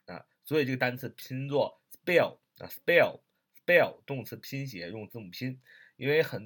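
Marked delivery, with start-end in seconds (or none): 1.9-4.0 "spell" with the p as an unaspirated b, like "sbell"; p read as b. Shown as a demonstration. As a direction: say "spell" as "sbell", slow and punched. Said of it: "要读B啊，B".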